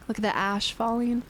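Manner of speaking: ordinary talk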